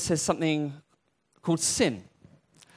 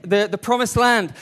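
ordinary talk, nothing marked

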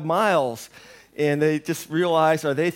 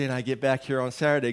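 ordinary talk, nothing marked

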